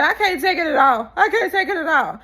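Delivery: imitating a crying castmate, half laughing; mock crying. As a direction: ham up a tearful breakdown. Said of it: "she said".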